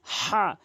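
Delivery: হা pushed out with pressure from the throat; pressed ha demo. Shown as a demonstration. Teacher showing the pressed হা of আলহামদু. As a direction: squeeze হা from the throat. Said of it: "হা চাপ দিয়ে বলবেন".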